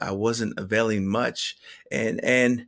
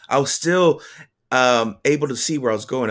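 ordinary delivery

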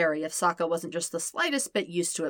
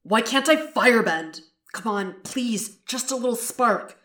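frustrated pleading; 5 semitones higher, 5 dB louder than usual. Acted. Zuko straining to firebend, pleading.

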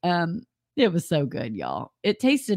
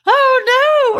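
imitating her yell, much higher and louder; retold yell; 10 dB louder than usual. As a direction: cry out "Oh, no" in dismay. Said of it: "yelled something".

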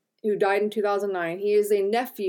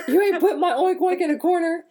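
high, loud, laughing hillbilly put-on; 9 semitones higher, 6 dB louder than usual. Riffing on the pig joke to her cohost, laughing. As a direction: squeal it out mid-laugh.

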